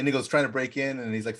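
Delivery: usual speaking voice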